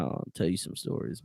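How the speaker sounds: hushed, secretive promise; whisper. Real, not acted. To his co-host about off-camera prison stories, whispered. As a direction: lean in and whisper it.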